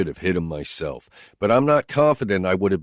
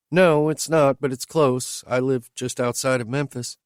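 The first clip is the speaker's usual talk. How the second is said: higher, lightly voiced woman asking bluntly; higher woman's voice, questioning her driver; Whitney's voice; 8 semitones higher, 3 dB louder than usual. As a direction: lift into her voice, direct and searching.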